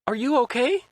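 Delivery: put-on high, anxious young man; worried high voice. A narrator's reading. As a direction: ask with nervous concern. Otacon worried on codec.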